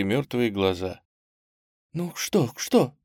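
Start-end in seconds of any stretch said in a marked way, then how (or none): 1.9-2.9 high, hushed anxious question; frightened whisper. A narrator's reading. Old lady asking about the body, frightened. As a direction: ask it scared, half-whispered. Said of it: "испуганным шёпотом спросила".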